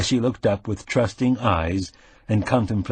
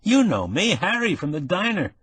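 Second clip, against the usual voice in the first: higher, excited pal voice; brash young Harry; 9 semitones higher than usual. Acted. Nick bursts in on the wounded man, high and excited. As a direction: play the concerned buddy, eager.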